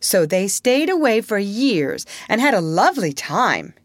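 high, self-satisfied storytelling; complacent. Acted. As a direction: tell it like a girl sure of her tale. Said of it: "Wendy said complacently".

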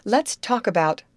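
high, loud burst of an idea; bright exclamation. A narrator's reading. Amy changing the subject to clothes, bright exclamation. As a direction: exclaim it like a happy brainwave. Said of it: "exclaimed Amy with a bright thought".

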